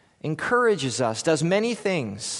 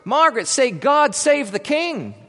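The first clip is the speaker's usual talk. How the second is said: loud, higher call from the shore; yelled from shore; 7 semitones higher, 4 dB louder than usual. Acted. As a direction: call out across the water. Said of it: "Someone from shore yelled".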